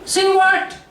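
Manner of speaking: high, bewildered squeak of a question; panicked inner voice. Retold or imitated. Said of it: "the other voice say".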